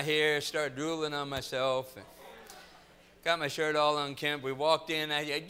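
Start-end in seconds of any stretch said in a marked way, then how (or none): none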